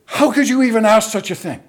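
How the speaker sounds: emphatic acted-out objection. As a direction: protest as if shocked to be asked. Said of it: "Why are you so emphatic?".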